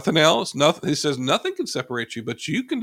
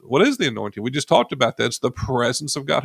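ordinary talk, nothing marked

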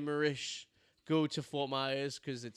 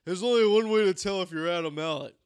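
louder, slow and laughing; mock test; 3 semitones higher, 8 dB louder than usual. Testing the friend at the bar, laughing slowly. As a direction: dare him with a grin. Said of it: "And I go".

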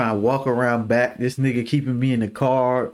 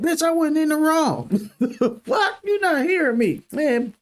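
1 high mock outburst. Retold outburst at dad.

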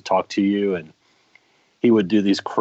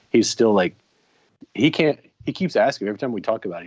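ordinary talk, nothing marked

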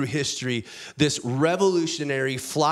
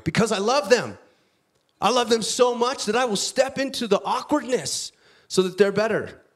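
passionate insistence; 9 semitones higher than usual. Acted. Paul explaining to the awkward room why he speaks up, fervent. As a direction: plead his love with rising fervour.

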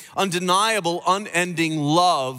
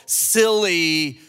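ordinary talk, nothing marked

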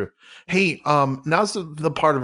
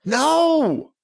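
high, loud cry of no; mock outrage; 12 semitones higher, 3 dB louder than usual. Host yelping no at the sponsor, high and loud. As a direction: yelp it in fake outrage.

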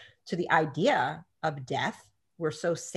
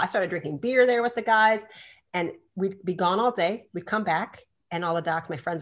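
ordinary delivery

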